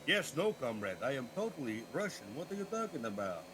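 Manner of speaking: lightly put-on Russian accent; a lazy fake Russian, half-hearted; hammed-up Russian accent, barely there; mock Russian accent. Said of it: "kind of bullshit".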